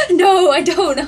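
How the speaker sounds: high, loud, through laughter; giggly retold reply; laughing admission. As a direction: say it while cracking up. Laughing at retold mutual confusion.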